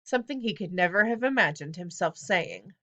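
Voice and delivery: usual speaking voice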